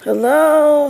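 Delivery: high, bright sing-song hello; excited greeting. Real, not acted. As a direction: call out a cheerful high hello. Greeting viewers as they join.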